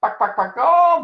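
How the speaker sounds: high, loud chicken clucks; mock them like a clucking hen. Gang taunting cub, loud mocking chicken clucks. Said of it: "they shouted".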